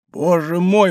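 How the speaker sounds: high anguished exclamation; восклицание. A narrator's reading. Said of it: "воскликнул палач". (в отчаянии восклицая)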